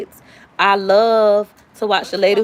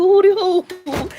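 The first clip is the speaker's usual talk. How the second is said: high, slow whimpered hootie hoo; a sniffling kid repeating the call; hammed-up teary hootie hoo; mock crying; 10 semitones higher, much slower than usual. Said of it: "boo-hoo crying".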